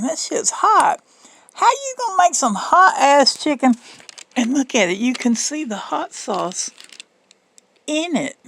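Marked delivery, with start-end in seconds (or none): 0.0-3.7 shocked by the heat. Real, not acted. Reacting to spicy chicken.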